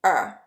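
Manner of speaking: short, reduced schwa for «р». Shown as a demonstration. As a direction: say «р» as a brief neutral "uh". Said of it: "gives a reduced schwa sound".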